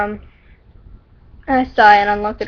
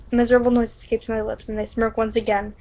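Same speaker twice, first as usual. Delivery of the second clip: ordinary talk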